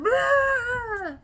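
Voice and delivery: very high, loud wail; hammed-up ugly-cry shriek, drawn out; mock bawling. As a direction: wail it out like a sudden breakdown. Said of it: "I just started crying everywhere".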